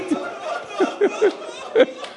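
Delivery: a high, voiceless sob; unvoiced weeping gasp; breathless high sob. Real, not acted. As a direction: let a breathy sob escape. Sob during rawzeh recital.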